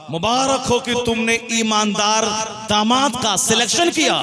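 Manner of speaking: loud, high acclaim; congratulating friends. Retold or imitated. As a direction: proclaim congratulations like a chorus of friends. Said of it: "सारे दोस्तों ने कहा".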